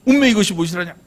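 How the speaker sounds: hammed-up Jeolla dialect. Shown as a demonstration. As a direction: put on a Jeolla-do accent, astonished. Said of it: "전라도 말로 뭐예요?".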